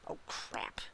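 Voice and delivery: whispered, under the breath; muttered curse. A narrator's reading. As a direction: mutter it almost silently. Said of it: "I muttered".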